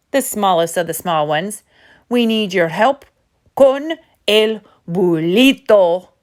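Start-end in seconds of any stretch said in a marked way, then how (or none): 2.1-6.1 urgent chihuahua plea. Acted. Chihuahua pleading for help.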